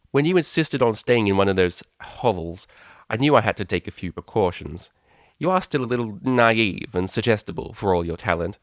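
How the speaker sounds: cold, imperious and dismissive; mother cutting short the pleasantries; stern mother. Acted. Mother explaining spying.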